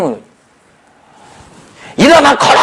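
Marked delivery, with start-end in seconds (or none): 2.0-2.6 high, sudden commanding cry; shouted command. Retold or imitated. Paul staring at the lame man, commanding in a shout. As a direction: call it out as an order.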